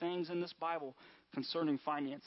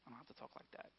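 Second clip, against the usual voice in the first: whispered reply; 2 semitones lower, 16 dB quieter, faster than usual. Retold or imitated.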